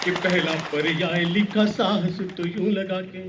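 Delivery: rhythmic, declaiming verse; poem recitation. Acted. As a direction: recite it like a stage poet.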